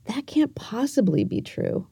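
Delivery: soft whispered dismissal; doubting inner voice. Retold or imitated. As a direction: whisper it like your brain brushing off the danger. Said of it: "my brain is telling me".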